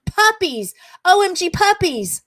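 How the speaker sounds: high, loud acted exclamation; frantic correction. Texter fixing an embarrassing autocorrect, frantic.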